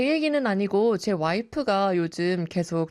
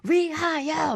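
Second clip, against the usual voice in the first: toast cheer; 10 semitones higher, slower than usual. Retold or imitated. Next table's drinking toast.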